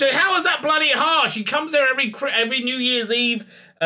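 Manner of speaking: high-pitched, hammed-up complaining; a retold moan pitched high and fast; mock whiny rant. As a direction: whinge it up high like a petty grump.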